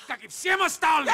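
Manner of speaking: furious yell at his wife, pitch climbing; angry shout. Acted. Husband yelling at his wife in a bitter quarrel, shouting. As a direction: bellow the question at her.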